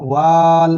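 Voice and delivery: ওয়াল with an overlong, held "aa"; wrongly stretched vowel. Shown as a demonstration. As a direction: stretch ওয়াল out on one level note. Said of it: "এইভাবে পড়তে হবে না".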